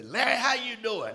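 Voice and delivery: put-on high, loud, cheery old-timer hello; Bob's hearty greeting. Old timer greets the newcomer in a high, loud voice. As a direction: call out warmly across the room.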